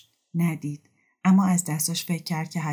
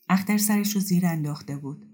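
plain reading voice

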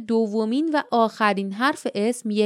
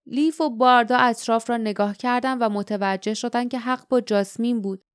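ordinary delivery

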